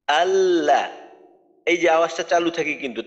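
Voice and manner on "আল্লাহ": আল্লাহ intoned louder with doubled lam held; full recitation. Shown as a demonstration. Teacher recites for the class, louder and sustained. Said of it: "পুরো উচ্চারণটা শুনাই".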